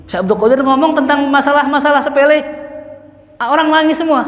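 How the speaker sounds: high, loud preaching burst; preacher raising his voice high and loud; fiery preaching. Acted. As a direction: preach it out at full force. Preaching peak.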